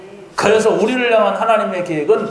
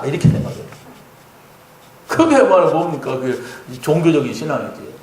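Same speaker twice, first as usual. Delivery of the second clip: normal talking voice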